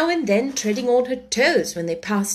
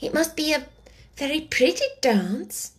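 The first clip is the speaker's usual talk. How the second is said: timid; 6 semitones higher than usual. A narrator's reading. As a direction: venture it timidly, pitched up. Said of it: "said Alice timidly".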